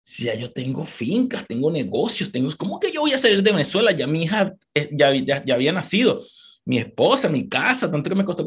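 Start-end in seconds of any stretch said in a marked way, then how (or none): none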